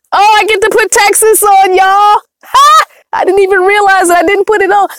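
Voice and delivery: high, excited whoop of discovery; delighted realization. Real, not acted. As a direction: burst out high with sudden glee. Delighted to add Texas to map.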